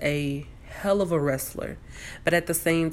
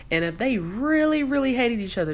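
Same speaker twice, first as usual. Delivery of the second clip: really pushed high; emphatic really; 8 semitones higher than usual. Real, not acted. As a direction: lean hard on really. Emphatic pleading point.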